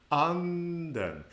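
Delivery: "Anden" starting high and falling down; acute pitch accent. Shown as a demonstration. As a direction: begin "Anden" high on a long first syllable, then drop. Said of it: "pitch starts high and falls down".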